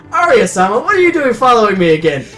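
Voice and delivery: ordinary delivery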